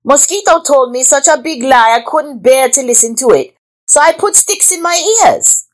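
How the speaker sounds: high-pitched creature voice, defending itself; protesting iguana. A narrator's reading. Iguana answers the snake's charge, protesting high. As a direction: plead innocence in a high voice.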